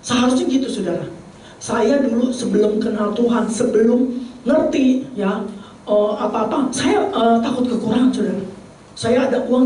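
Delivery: normal talking voice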